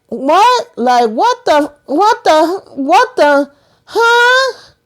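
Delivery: high, squeaky repeated bafflement; stunned disbelief. Acted. Vlogger reacting to the bathroom twist, stunned disbelief.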